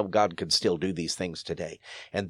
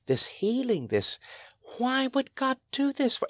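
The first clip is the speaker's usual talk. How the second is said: imitating sobbing girl; 14 semitones higher, 3 dB quieter than usual. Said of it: "and she's sobbing".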